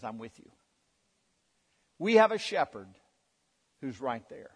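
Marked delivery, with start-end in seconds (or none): 0.0-0.5 soft reassurance. Retold or imitated. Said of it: "He says".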